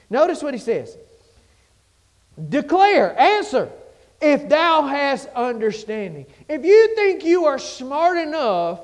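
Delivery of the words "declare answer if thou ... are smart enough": loud, high preacher's thunder; full-force preaching. Acted. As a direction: hammer it at the congregation.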